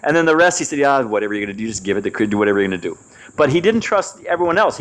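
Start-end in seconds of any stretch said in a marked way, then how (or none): 0.8-3.0 low, shrugging resignation; lower, give it up with a shrug; an aging father conceding to his son; resigned old father. Retold or imitated. Father resigned about the will.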